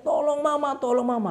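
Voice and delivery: high-pitched call for Mama; acted plea of a falling chick; cry for help. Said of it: "Dia teriak". (teriakan minta tolong)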